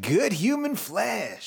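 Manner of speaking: high, drawn-out mockery; mock ghoulish relish. Cohost mocks gross line, creepy relish. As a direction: savour each word, slowly and high.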